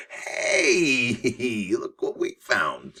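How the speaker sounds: a lightly voiced grandkid, a bright "hey" then a slow, amused "look what we found"; a hint of a thrilled grandchild; excited grandchildren finding a box. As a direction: play the grandkid stumbling on treasure, mildly hammed. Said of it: "your children or grandchildren come across and go".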